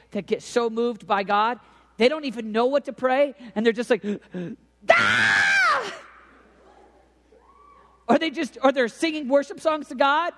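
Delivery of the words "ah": sharp intakes, then a long loud cry; gasp, then burst out yelling; gasping prayer yell. Retold or imitated. Imitating college kids moved by God, gasping loud cry.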